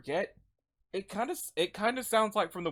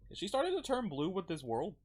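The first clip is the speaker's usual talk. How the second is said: ordinary delivery, nothing marked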